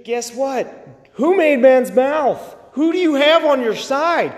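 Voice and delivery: high, forceful challenge to the congregation; impassioned, exhorting preacher; fervent preaching. Real, not acted. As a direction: press each question home with rising fervor.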